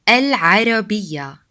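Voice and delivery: Al-Arabiya with a throat ain, alʕarabiya; Arabic pronunciation. Shown as a demonstration. Teacher saying the word for Arabic. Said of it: "have the ain sound".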